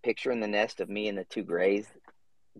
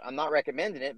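ordinary talk, nothing marked

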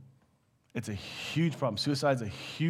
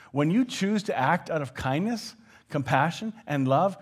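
ordinary talk, nothing marked

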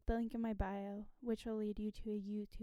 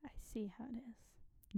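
breathy mock whisper; 6 dB quieter than usual. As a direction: whisper it with a knowing edge. Repeats line as whisper.

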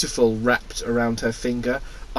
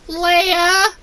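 high whiny Luke voice; 4 dB louder than usual. Acted. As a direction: whine like a needy kid. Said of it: "Luke said in a whiny voice".

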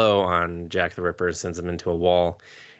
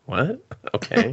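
baffled; 11 semitones higher than usual. Retold or imitated. Viewer's baffled reaction.

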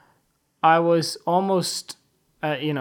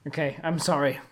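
ordinary delivery

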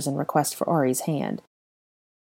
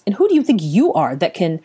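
loud, higher, outraged; angry retort, cut off; indignant challenge; 4 semitones higher, 6 dB louder than usual. A narrator's reading. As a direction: challenge his authority, affronted.

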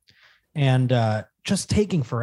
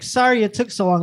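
high, louder apologetic driver voice; mock-bright apology, raised pitch; imitating the driver; 10 semitones higher, 4 dB louder than usual. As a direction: chirp an apology like the sweet driver. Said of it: "And she's like".

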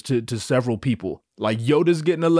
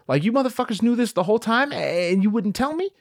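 high-pitched indignant outburst; mock outrage, pitched high in disbelief; pissed off; 9 semitones higher than usual. [name] confronts those who hid the truth, pissed off. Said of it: "I'm pissed at everyone".

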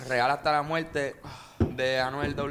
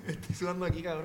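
laughing; 5 semitones higher than usual. Real, not acted. Sweating from spicy chips, laughing.

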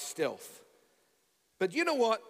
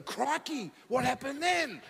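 higher-pitched, eager Aussie croc-hunter voice; lightly hammed-up wildlife-presenter impression; Steve Irwin; 7 semitones higher than usual. Passenger hanging on a crocodile story, Steve Irwin voice. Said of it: "talking like Steve Irwin".

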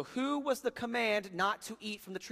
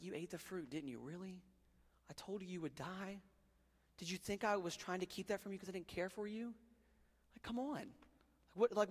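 much softer, disappointed but caring father; gentle father; 3 semitones higher, 7 dB quieter than usual. Retold or imitated. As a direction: plead quietly like a hurt dad. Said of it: "God's like".

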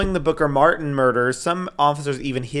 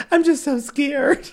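mock scared; 13 semitones higher, slower than usual. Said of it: "that's the energy that I".

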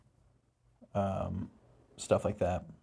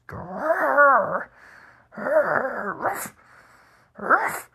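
imitated high growl rising into sharp loud ruffs; dog growl and bark; 7 dB louder, much slower than usual. Storyteller imitating the parents' dog demanding attention, high growls and barks. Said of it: "she jumps down and she starts".

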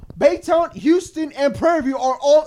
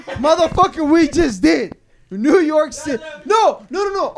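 loud, raised-pitch arguing; heated retort; 4 semitones higher, 6 dB louder than usual. Real, not acted. New Yorker shouting back at his friend, heated. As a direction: shout over them to win the point.

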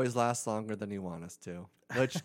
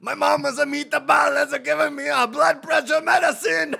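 shouting Italian mobster, much higher; Italian mafioso accent; 11 dB louder than usual. Pretend. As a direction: yell it like a mob guy. Italian mafioso bit continues.

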